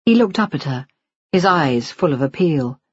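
plain reading voice

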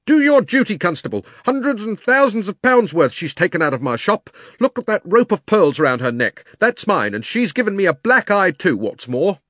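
high, loud, indignant tradesman demanding his due; a robbed shopkeeper ranting at the constable; angry fat shopkeeper. Acted. Fat man voiced in story.

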